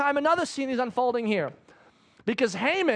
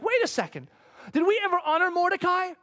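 sudden realization; 9 semitones higher than usual. Retold or imitated. King suddenly remembering.

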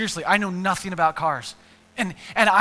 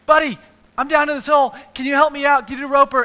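loud, higher call up to a passing buddy; calling to a friend; 6 semitones higher, 11 dB louder, faster than usual. Acted. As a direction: holler up hopefully. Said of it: "and he's like".

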